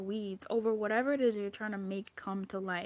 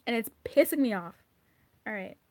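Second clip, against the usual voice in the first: normal talking voice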